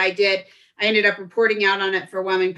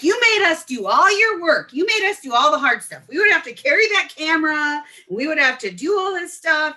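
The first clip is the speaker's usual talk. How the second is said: high, hammed-up grievance; accusing complaint; 8 semitones higher, 3 dB louder than usual. Bouncer complaining to his old classmate, mock accusing. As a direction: act out an old classmate's griping.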